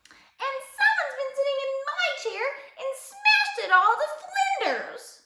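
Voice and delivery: very high squeak, growing bigger; squeaky baby bear. A narrator's reading. Baby bear finds his chair smashed, squeaking bigger. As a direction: squeak it out, building in volume. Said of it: "squeaked the baby bear in a little bit bigger voice".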